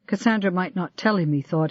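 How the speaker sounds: ordinary delivery, nothing marked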